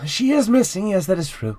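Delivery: doorman voice. Acted. Doorman answers in character.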